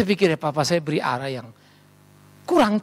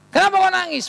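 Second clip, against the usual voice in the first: high, raised-voice demand; acted fatherly rebuke, pitched up; scolding father; 12 semitones higher, 3 dB louder than usual. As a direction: tell the crying boy off sharply. Acting father scolding crying son.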